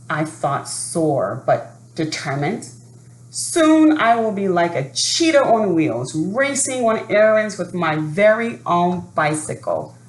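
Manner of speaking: lively read-aloud storytelling voice; story narration. A narrator's reading. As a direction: read it like a children's picture book. Reading a picture book aloud.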